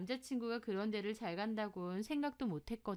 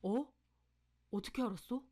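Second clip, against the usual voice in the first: startled. Acted. Boyfriend asked about a broken engagement, startled. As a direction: caught off guard, mildly startled. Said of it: "남친도 흠칫 놀라더라고요".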